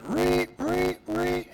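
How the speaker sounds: high, loud, repeated alarm screech; imitated alarm. Game master springs the audible alarm on sleeping players, loud high siren imitation. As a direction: screech "ree" like a siren going off. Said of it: "as the audible alarm".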